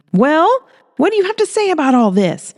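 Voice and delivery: high, sharp demanding woman's voice; hands-on-hips challenge, pitched well up; Holly, demanding. Acted.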